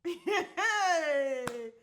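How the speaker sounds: loud, high, drawn-out laugh; real laugh. Real, not acted. Storyteller cracks up at the suitor's question, real laugh. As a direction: burst out laughing long and high.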